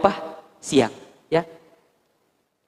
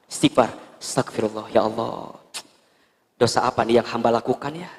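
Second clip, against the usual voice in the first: hushed prayer; 7 semitones lower, 7 dB quieter than usual. Acted. Ustaz acts wife's quiet prayer.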